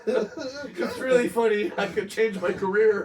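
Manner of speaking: high, loud laughing caller; hammed-up caller. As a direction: whine it out high and giddy. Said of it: "make the nine one one call".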